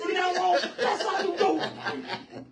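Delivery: high, loud, slow laughing cheer; laughing shout. Real, not acted. Host celebrating the intro, shouting through laughter. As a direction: stretch it out laughing.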